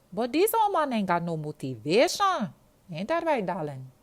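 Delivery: put-on a sassy Jamaican girl giving up on the phone; weary Jamaican girl. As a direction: sigh it out in a big, high Jamaican voice.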